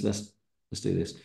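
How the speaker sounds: ordinary delivery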